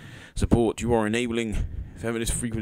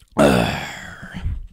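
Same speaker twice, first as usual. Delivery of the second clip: big, breathy exhale; exasperated gust of breath; loud sigh; 7 semitones lower, 9 dB louder than usual. Real, not acted. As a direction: heave a loud, long sigh. Exasperated, pauses over tweets.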